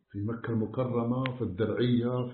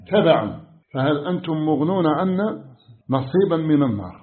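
loud impassioned sermon, pressing hard; preaching at full force; 3 semitones higher, 8 dB louder than usual. Acted. Preacher warning the congregation of Judgment Day, loud and impassioned.

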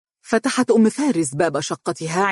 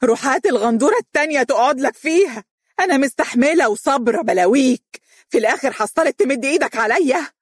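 fast raging cry on the stairs; furious shout; 9 semitones higher than usual. A narrator's reading. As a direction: scream at the absent husband. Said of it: "وهي تصيح فيه".

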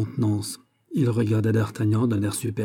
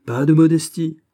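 loud, higher-pitched protest; exclaimed; 6 semitones higher, 7 dB louder than usual. A narrator's reading. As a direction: cry it out, voice raised. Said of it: "s'écria D'Artagnan". (s'écrier, d'une voix forte et plus aiguë)

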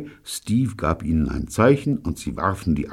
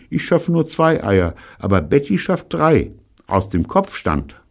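Evelyn's voice; 11 semitones higher, 4 dB louder than usual. Acted. Evelyn answers Pirolo.

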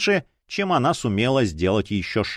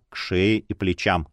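ordinary delivery, nothing marked

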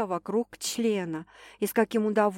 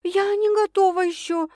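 higher, anxious female voice; pleading girl; 11 semitones higher, 4 dB louder than usual. A narrator's reading. Girl telling the man she's not ready, higher and pleading. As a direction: beg him, voice pitched up.

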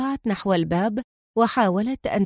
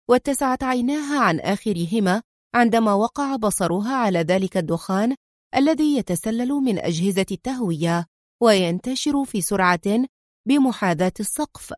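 usual speaking voice

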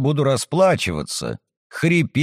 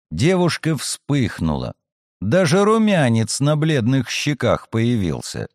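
plain reading voice

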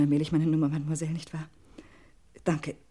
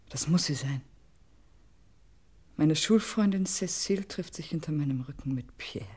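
shocked whisper. Acted. Realizing her friend betrays her.

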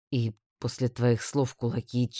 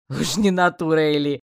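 louder, spluttering through a laugh; choking with laughter; 3 semitones higher, 7 dB louder than usual. A narrator's reading. Orc teasing the woman, choking on laughter. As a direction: choke on the joke as you say it. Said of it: "поперхнулся от смеха орк".

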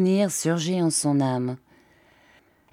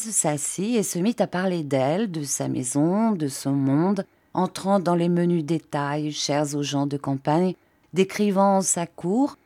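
plain reading voice

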